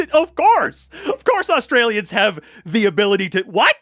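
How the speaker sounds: loud, high incredulous laughter; amused, squealing disbelief; laughing disbelief. Real, not acted. Laughing incredulously at chat's comment.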